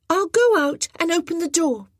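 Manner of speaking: put-on an eager boy offering help; young boy voice. A narrator's reading. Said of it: "he said".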